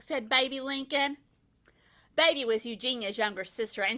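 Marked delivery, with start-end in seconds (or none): none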